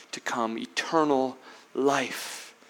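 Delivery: breathy whisper on the last words; hushed reverent whisper. Acted. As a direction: let the promise fall to a whisper. Hushed close of the verse.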